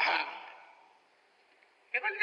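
ordinary talk

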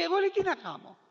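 high, fast whining voice; high-pitched, hurried mock complaint; whiny quote. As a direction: mimic a helpless believer, high and rushed.

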